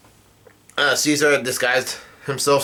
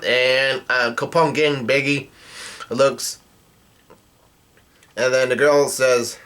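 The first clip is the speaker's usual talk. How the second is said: ordinary talk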